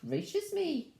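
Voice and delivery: high, slow exclamation; drawn-out mock astonishment; shocked exclamation. A narrator's reading. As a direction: exclaim like an astonished storybook mother. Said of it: "and exclaimed".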